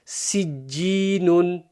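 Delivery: very slow Sijjinun, syllables joined; slow blended demo. Shown as a demonstration. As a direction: stretch out Sijjinun slowly. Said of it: "blending syllables demo".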